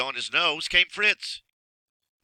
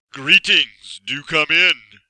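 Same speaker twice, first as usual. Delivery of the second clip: booming magician; 4 semitones higher, 12 dB louder, much slower than usual. Acted. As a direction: greet him like a looming sorcerer. Magician greets boy at door.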